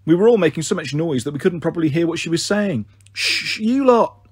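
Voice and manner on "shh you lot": slow, high hushing; shushing. Acted. Big sister hushing siblings by the phone, slow shush. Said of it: "I said".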